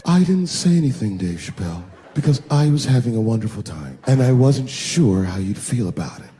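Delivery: low, smooth seductive purr; sultry voice. Acted. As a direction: coo it sweetly to him. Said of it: "that sultry voice".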